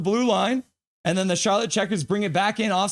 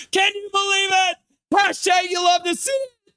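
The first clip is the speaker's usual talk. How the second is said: very high, celebratory shout; elated; 12 semitones higher than usual. Real, not acted. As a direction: cry out in disbelieving joy. Celebrates the title win.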